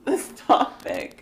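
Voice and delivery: whispered, slow and drawn out; playful whisper. Pretend. Introducing the topic to a co-host, playfully whispered. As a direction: whisper it slowly, stretching the words.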